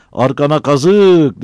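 loud, high, drawn-out curse; slow, heated yell; angry retort. Acted. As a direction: throw the insult back, stretched and loud. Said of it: "Battal kızdı".

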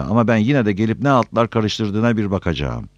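ordinary delivery

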